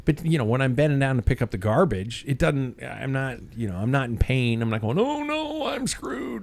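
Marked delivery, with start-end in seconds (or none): 5.0-6.4 mock panic. Imagined self in pain, bending down.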